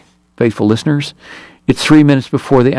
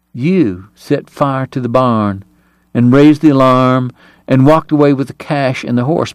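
ordinary delivery

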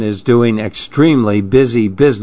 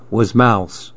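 plain reading voice

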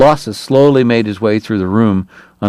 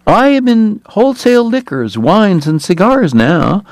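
a big man's piping voice trailing off; high-voiced Hoover; 11 semitones higher than usual.

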